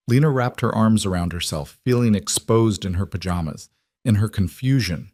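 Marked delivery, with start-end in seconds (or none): none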